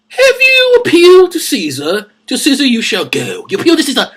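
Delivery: lightly hammed-up ruler, pitched high; hammy high-pitched ruling; theatrical Festus. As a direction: pronounce it high like a pompous judge. Acts out Festus answering Paul.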